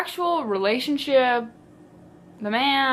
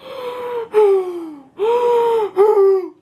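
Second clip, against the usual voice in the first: long theatrical gasping; mock hyperventilating gasp; 10 semitones higher, 5 dB louder than usual. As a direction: gasp for air in mock panic. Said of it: "I'm hyperventilating".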